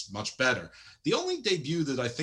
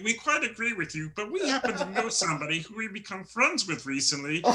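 much higher, theatrical villain voice; hammed-up cult leader, pitched up; Evil Uno voice; 6 semitones higher, 4 dB louder than usual. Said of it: "Evil Uno goes".

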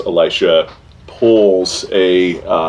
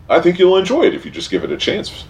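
high, quick, coaxing gentleman; refined golem voice; 13 semitones higher, much faster than usual. Acted. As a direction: offer the book with polished charm. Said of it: "and says".

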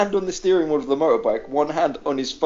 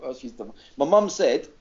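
ordinary talk, nothing marked

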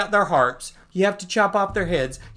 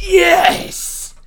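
much higher, louder triumphant yes; thrilled cheer; 9 dB louder than usual. Acted. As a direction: cheer like a scientist seeing success. Guy thrilled he can talk.

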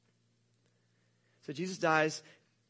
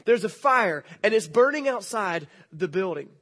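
normal talking voice

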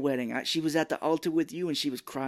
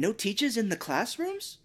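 higher, slowed disbelieving question; mock incredulous; 9 semitones higher than usual. Retelling the teacher's baffled question, mock incredulous. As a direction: sound baffled at the news.

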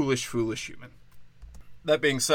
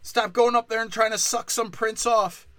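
much higher, screeching angry dad; Bill Burr dad screaming; 10 semitones higher than usual. Acted. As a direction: shriek at her like a furious dad.

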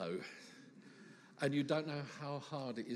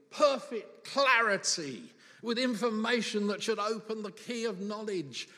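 exasperated; 7 semitones higher, 8 dB louder than usual. Acted. Teacher's frustration acted out.

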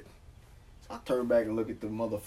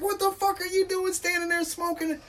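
high-pitched mock scolding; mildly heated, high imitation of a rant; retold fury. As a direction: imitate a furious woman telling someone off. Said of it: "She's fucking furious".

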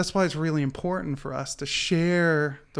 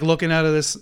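ordinary delivery

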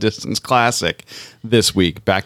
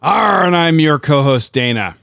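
mock pirate greeting, high and loud at the start; pirate accent; 6 semitones higher, 3 dB louder than usual. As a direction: introduce yourself as a hammed-up pirate, pitched up. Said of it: "To pirates everywhere".

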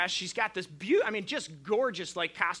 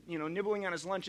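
usual speaking voice

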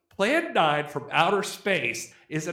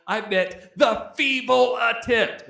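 slow, loud and high, every word punched; heavy sarcastic stress, louder and slower; all-caps emphasis; 6 semitones higher, 5 dB louder than usual. Real, not acted. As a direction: spit out feeble attempt like it's in capitals. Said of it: "in all caps".

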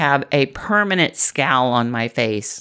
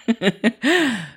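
light laugh; 6 semitones higher than usual. Real, not acted. Laughs while coaching viewer.

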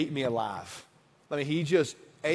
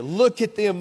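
higher and louder, accusing; raging anger; 5 semitones higher, 6 dB louder than usual. A narrator's reading. Pastor voicing the enraged psalmist, loud angry. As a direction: jab the word Look as if pointing in fury. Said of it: "He's still raging with anger".

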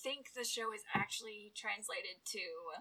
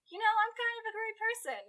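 mock boast; 11 semitones higher, 6 dB louder than usual. Real, not acted. Playful self-praise.

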